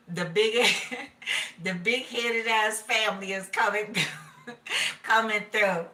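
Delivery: giggling breaks around the words; laughing. Real, not acted. Telling viewers the family is coming, laughing. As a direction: laugh through the line.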